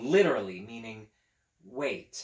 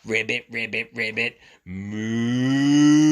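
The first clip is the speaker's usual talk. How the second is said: 1 animal noises; 4 semitones lower, 10 dB louder, slower than usual. Pretend. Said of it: "mooing and Piggy".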